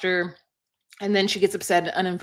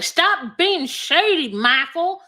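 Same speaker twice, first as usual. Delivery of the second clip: slow, higher, drawn-out warning; mock scolding; 8 semitones higher, 4 dB louder, slower than usual. Wife telling husband to stop being shady, slow mock scold. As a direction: wag a finger in the voice, stretch each word. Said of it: "she's like".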